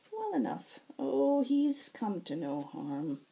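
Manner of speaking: lightly voiced tired healer, wry and exasperated; weary healer. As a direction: explain it like a worn-out nurse. Nether reassures Zack.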